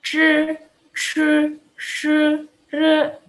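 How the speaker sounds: "C, c, c, re" as held Mandarin retroflex syllables; high, separate syllables for repeating; consonant drill. Shown as a demonstration. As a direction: sustain zhi, chi, shi, ri evenly. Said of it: "Ini gimana?".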